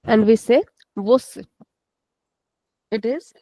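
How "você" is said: você with the final ê closed and cut short; closed short ending. Shown as a demonstration. Teacher giving the correct word, short closed ê in você. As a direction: say você without extending the last vowel. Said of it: "we don't extend the sound".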